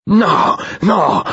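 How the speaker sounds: high, loud cry of despair; desperate howl. A narrator's reading. Wolf cries out to his human companion, desperate howl. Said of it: "hurla Œil de Nuit avec désespoir".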